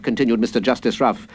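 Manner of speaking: plain reading voice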